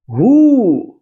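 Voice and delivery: हु with a long oo, high and loud; model word who. Shown as a demonstration. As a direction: stretch the oo in हु. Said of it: "देखिए इसे क्या पढ़ते हैं". (हु को लंबे ऊ के साथ बोलें)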